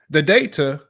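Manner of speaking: the word "data" slammed down for emphasis; stress on DATA. Real, not acted. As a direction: land hard on "data". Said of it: "Why am I saying it like that?".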